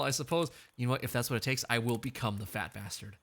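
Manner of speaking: ordinary talk, nothing marked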